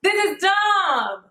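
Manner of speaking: real annoyance, words dragged out slowly; fed up. Real, not acted. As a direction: drop the polite voice and let the irritation out. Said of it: "I cannot use my customer service voice when I get upset".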